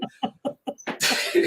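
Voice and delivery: high breathy screechy cackle; villain cackle. Pretend. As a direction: cackle like a cartoon jet villain. Starscream laugh after the bit.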